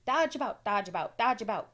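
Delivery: loud, high, urgent goose; a bossy goose barking orders; goose crying out. A narrator's reading. Goose shouting orders to Wilbur, loud and high. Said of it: "cried the goose".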